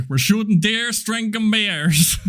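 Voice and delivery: put-on amateur Texas accent, slowing at the end; Texan twang. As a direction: attempt a hammed-up Texas drawl. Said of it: "That was twang in it?".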